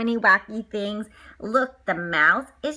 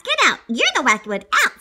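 angry teacher; 7 dB louder, faster than usual. Acted. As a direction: throw the kid out of class. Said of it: "said Miss Bass".

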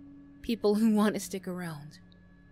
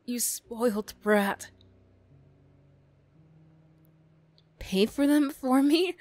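slow contemptuous scoff then higher, louder; scornful outburst; 4 semitones higher, 6 dB louder, slower than usual. Acted. Bully scorning the classmate offering to pay her classes, contemptuous. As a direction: sneer at the rich kid's offer.

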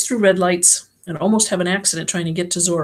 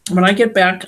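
ordinary delivery

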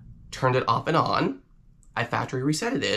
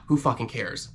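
ordinary talk, nothing marked

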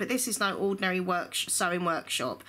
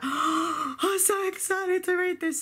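high, breathless gasp of delight; gasping excitement; 11 semitones higher, slower than usual. Shown as a demonstration. Booktuber acting out a thrilling new book, gasping and high. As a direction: squeal it like the best book ever arrived. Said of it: "it's all excitement, but at different levels".

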